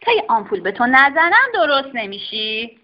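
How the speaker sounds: firm doctor's threat; stern doctor. Acted. Doctor threatening the dog with an injection, firm. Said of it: "بیرون پرید و گفت".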